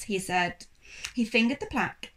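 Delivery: ordinary delivery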